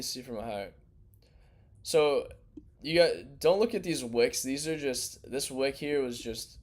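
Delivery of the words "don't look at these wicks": usual speaking voice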